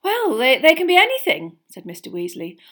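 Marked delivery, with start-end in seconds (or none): 0.0-1.6 a touch animated, high and loud; hesitate, then answer with enthusiasm; lightly voiced eager dad; explaining father. Father answering a curious question.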